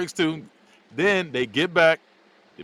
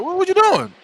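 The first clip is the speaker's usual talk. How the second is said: startled squeal; 6 dB louder than usual. Retold or imitated. Startled girl, retold.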